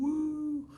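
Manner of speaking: high, soft "woo"; half-volume crowd cheer; mock cheer. Playful cheer for wall of fame.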